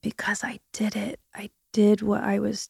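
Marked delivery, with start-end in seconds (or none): 0.0-1.5 softer, whispered confession. Real, not acted. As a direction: let it out in a whisper. Confessing her surgery.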